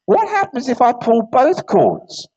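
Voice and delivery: high, slow, polite question; curious vicar voice. Acted. Vicar asks about pulling both cords, high and slow. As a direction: ask it carefully like a genteel vicar.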